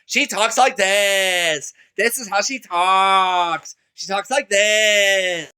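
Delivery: very slow, stretched-out put-on voice; imitating a woman's voice. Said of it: "You know how she talks?".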